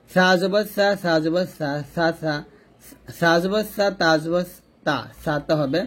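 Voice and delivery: usual speaking voice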